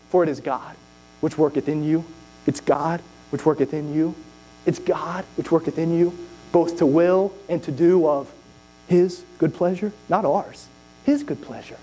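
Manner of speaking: low measured reading of scripture. Acted. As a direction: let each word of the verse land.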